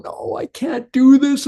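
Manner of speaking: high, pleading mock dread; wife dreading the accountability test; whiny refusal. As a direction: back away from the table. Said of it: "They're going".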